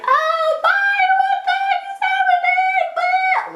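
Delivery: very high, drawn squealed goodbye; flustered squeal. Retold or imitated. Storyteller acting out her goodbye to the guy, squealed sky-high. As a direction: squeal it loud and sky-high, almost sung.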